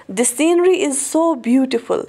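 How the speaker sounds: slow, carefully spaced example sentence; teacher reading the example slowly; slow model sentence. Shown as a demonstration. Teacher to Hindi-speaking students, slow clear example. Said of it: "is हमने लगाया".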